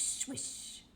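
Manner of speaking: breathy whispered swoosh; whispered swish. A narrator's reading. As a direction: whisper the spray of the spout. Whale spout sound effect.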